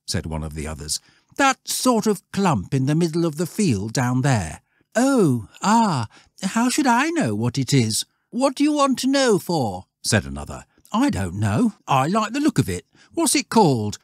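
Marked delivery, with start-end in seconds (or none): 1.4-4.6 put-on high-pitched boy's voice; impatient lad, voice pitched up; schoolboy Stanley. 4.9-9.9 high, loud schoolboy retort; dismissive boy, pitched right up; offhand schoolboy. Acted. Voices another boy. 10.9-14.0 put-on high, loud boy's voice; demanding lad, pitched well up; schoolboy Stanley.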